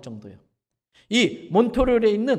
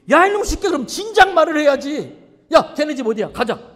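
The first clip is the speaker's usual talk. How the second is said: shouted, much higher and louder; furious father; 8 semitones higher, 9 dB louder than usual. Retold or imitated. Father furious the neighbor's kid broke the vase, shouting. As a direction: roar at the boy, then storm out.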